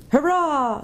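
very high, tiny mouse voice; shrill small voice. A narrator's reading. Mouse cheering from the doctor's feet, shrill and small. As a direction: pipe up like a valiant little creature. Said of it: "said a very shrill and small voice".

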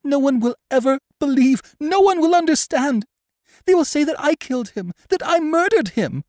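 very high, loud hysterical wail; distraught, high-pitched cry; tearful outburst. A narrator's reading. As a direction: wail in feverish despair. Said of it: "tears came in a storm".

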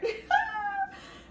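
very high, squealing laugh; delighted laugh. Real, not acted. Reader spotting the choker picture, high emotional laugh. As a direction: laugh up high, close to tears. Sees old pictures in cousin's book.

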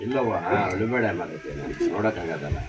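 low, hushed, pitying voice; pained sympathy. Real, not acted. Consoling a crying person he can't bear to watch, softly pitying. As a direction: drop low and soft, full of pity. Comforting someone who is crying.

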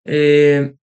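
ordinary talk

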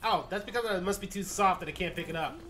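ordinary delivery

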